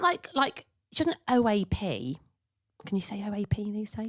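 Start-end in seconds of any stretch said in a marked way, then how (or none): 2.8-4.1 hushed unvoiced aside; whispered aside. Real, not acted. Checking if OAP is allowed, whispered aside. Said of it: "aside whispered".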